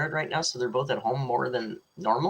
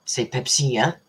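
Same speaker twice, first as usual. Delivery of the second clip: mock French; 3 dB louder than usual. Real, not acted. Jokey French phrases.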